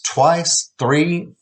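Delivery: ordinary delivery, nothing marked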